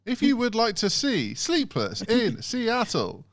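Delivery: high, slow robotic prompt; automated phone voice. Retold or imitated. Phone line telling caller to say yes, robotic. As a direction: mimic the recorded menu instruction. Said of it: "And so then you'd be like".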